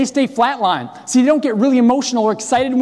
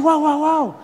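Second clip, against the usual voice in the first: higher, taken aback; startled whoa; 5 semitones higher than usual. Retold or imitated. Caught off guard by Mark's call, startled whoa. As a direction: stop him, startled. Calming Mark down.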